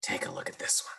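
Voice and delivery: hushed whisper to the class. Acted. Teacher whispering to young students, secretive. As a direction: lean in and whisper it.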